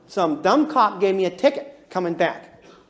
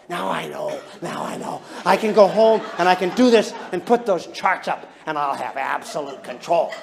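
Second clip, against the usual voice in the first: power-hungry scheming; 3 semitones lower than usual. Retold or imitated. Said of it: "interpret all this in the way of power".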